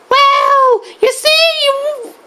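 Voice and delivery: high-pitched cartoon explanation; high, halting game-character voice; Diddy Kong.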